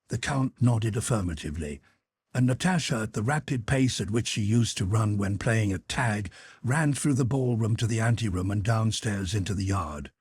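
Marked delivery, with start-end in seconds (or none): none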